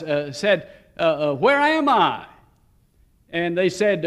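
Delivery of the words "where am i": lightly acted intimidated, exasperated comedian, pitched higher; mock-booming question with a hint of quaver; mildly quavering booming voice. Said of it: "a quavering yet bo-, booming voice".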